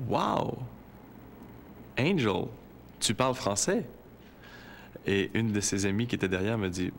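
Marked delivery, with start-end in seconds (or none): 0.1-3.9 surprised. Retold or imitated. Amazed she speaks French.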